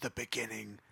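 breathy prophetic whisper to close; ominous whisper. Acted. Priest ending his warning to the party in a whisper. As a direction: hiss the final warning.

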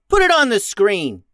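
high, loud captain's order; bossy young captain in charge; commanding. A narrator's reading. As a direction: call the order out firmly. Captain orders her crew.

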